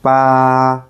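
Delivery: "Ba" held flat and steady; long level "Ba", like singing a note; first tone. Shown as a demonstration. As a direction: sustain "Ba" on one even note. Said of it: "flat and steady".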